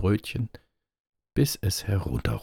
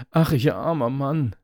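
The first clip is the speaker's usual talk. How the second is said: high, loud storybook lament; acted cry of woe, raised in pitch; 8 semitones higher, 6 dB louder than usual. A narrator's reading. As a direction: wail it out as the hapless carter. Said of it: "rief er".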